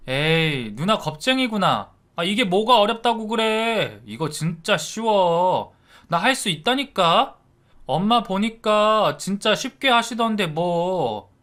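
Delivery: high, cocky taunting; teasing kid brother. A narrator's reading. Brother mocking his sister as a coward, high and cocky. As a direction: brush off your sister's worry.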